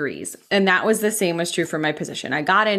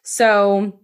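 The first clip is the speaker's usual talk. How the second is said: normal talking voice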